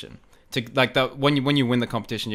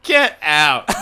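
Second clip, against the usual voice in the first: loud, higher-pitched outburst; astonished disbelief; 4 semitones higher, 8 dB louder than usual. Retold or imitated. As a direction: exclaim it like unbelievable news. Retells shock at lookalike compliment.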